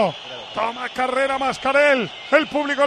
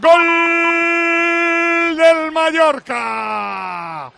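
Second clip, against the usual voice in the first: goal shout; 3 semitones higher, much slower than usual. Real, not acted. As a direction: stretch the goal call out. Goal scored, commentator calls.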